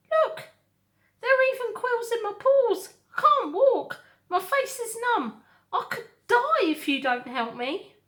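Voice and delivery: high, loud, whiny fox. Acted.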